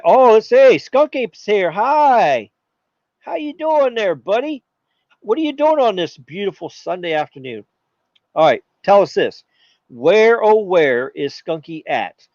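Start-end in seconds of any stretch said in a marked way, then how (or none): 0.0-7.6 cheery chat greeting. Real, not acted.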